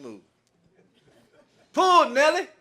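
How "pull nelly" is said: loud high call; calling out to the mule. Retold or imitated. Old farmer shouting to his mule to pull the car, loud call. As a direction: shout the command across the field.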